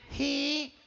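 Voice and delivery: put-on soft nasal cleft-palate boy; gangoso, nasal twang. Nasal-speaking boy answers his mother, soft gangoso voice. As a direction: answer through the nose, muffled. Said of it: "que era gangoso".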